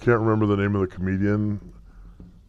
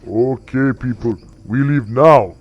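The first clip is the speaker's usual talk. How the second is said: Anbu-style order, louder, ending hard on "now"; gruff leader rallying; 3 semitones higher, 6 dB louder than usual. Acted. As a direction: order the tribe out with force. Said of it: "And Anbu says".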